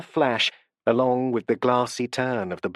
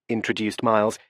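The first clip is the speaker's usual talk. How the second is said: ordinary delivery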